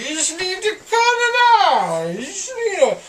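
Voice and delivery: high, loud, half sobbing; laughing through tears; laugh-crying. Real, not acted. Drugged laugh-crying rant.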